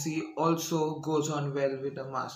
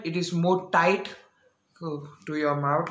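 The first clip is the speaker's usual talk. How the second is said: usual speaking voice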